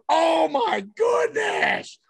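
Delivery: high, slow, drawn-out shout of amazement; stretched-out thrilled yell; amazed shout. Retold or imitated. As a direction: let it rip as the ball flies. Running bases watching homer.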